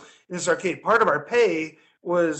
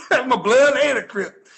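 laughing through the quote; 5 semitones higher, 5 dB louder than usual. Retold or imitated.

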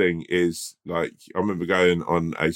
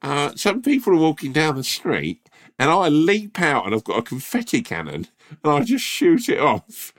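nervous eager pitch; 8 semitones higher than usual. Pretend. Voicing himself pitching prank nervously.

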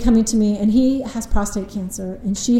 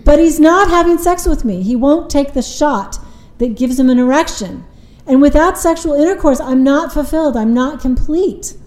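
higher and louder, pressing the grievance; a wife insisting she's not fulfilled, voice raised; upset complaint; 5 semitones higher, 5 dB louder than usual. Retold or imitated. Wife protesting to the therapist, raised higher and louder. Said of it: "and she said".

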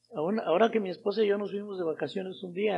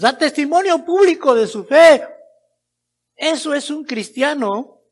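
high, loud declaration of faith; full-force preaching; 8 semitones higher, 10 dB louder than usual. Real, not acted. Preacher declaring belief in Jesus to the congregation, full-force preaching. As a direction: proclaim it to the whole congregation.

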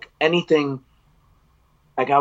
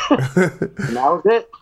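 laughing; 5 semitones higher, slower than usual. Real, not acted. Laughing after the happy ending.

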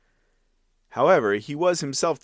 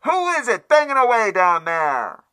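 much higher, louder sleepy maid snapping down; irritated maidservant yelling at the noise below; angry demand; 11 semitones higher, 5 dB louder than usual. Acted. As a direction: shout down annoyed from the window. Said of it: "and angrily demanded".

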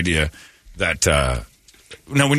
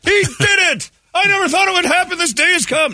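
dad's overjoyed cheer. Retold or imitated. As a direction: cheer like a proud dad at last. Imitating dad's joy.

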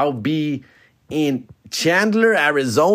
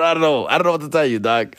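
usual speaking voice

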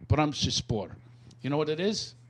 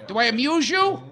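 higher-pitched, touchy wise-guy challenge; Joe Pesci; 8 semitones higher, 4 dB louder than usual. Acted. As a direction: imitate an offended movie mobster. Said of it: "It's like Joe Pesci in Goodfellas".

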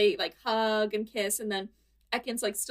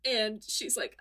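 normal talking voice

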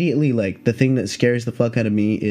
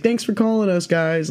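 higher-pitched, breezy handler voice; unbothered snake handler; 7 semitones higher than usual. Retold or imitated. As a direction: imitate a pro who finds this routine. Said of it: "like it's no big deal".

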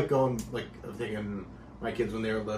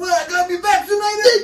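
high, loud outraged Brooklyn guy; Brooklyn accent; 12 dB louder than usual. Pretend. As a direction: shout it as a cartoon Brooklyn guy. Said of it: "that's my Brooklyn guy".

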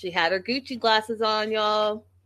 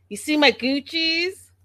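mock show-off; 8 semitones higher, 3 dB louder than usual. Mocking Lydia's glasses again.

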